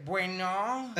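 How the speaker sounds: lightly voiced effeminate hello; a hint of a camp drag performer; imitating a camp answer. Caller imitates the birthday guy answering, lightly camp. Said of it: "Contesta el güey y me dice".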